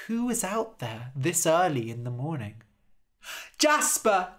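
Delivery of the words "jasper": higher, loud call. Acted. A woman calls for Jasper at dawn, calling out. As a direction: call his name across the field.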